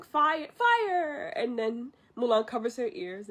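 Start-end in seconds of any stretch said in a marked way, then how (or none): 0.0-1.3 high, drawn-out cry of fire; acted commander's shout, stretched out; imitated battle command. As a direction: call out a mock order to the troops, pitched up. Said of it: "Shang is saying".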